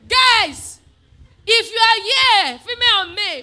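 loud, high drawn-out call. Real, not acted. Preacher addressing men and women present, loud call-out. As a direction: call the whole crowd to attention, slow and forceful. Calling out to the congregation.

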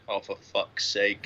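slow, low, resigned sigh of a conscience; weary inner voice. Retold or imitated. His conscience reacting to the accusing woman: slow low resigned groan. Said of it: "hear my conscience going".

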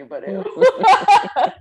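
loud and drawn out in laughter; helpless with laughter; real laughing fit; laughing hard. Real, not acted. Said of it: "I'm crying".

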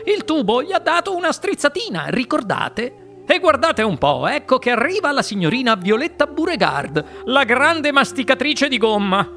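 cheerful Mr Wonka. Acted. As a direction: sell every word like a sweet-maker. Wonka chatters on.

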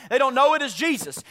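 ordinary talk

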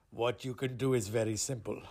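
low, hushed ominous answer; Angel of Death. Acted. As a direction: lay down terms softly and low.

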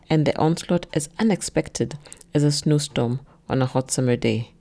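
ordinary delivery, nothing marked

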